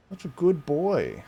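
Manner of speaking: pet praise. Real, not acted. Praising his cat.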